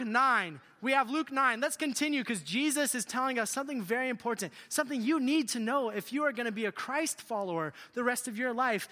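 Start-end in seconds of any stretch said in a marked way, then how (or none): none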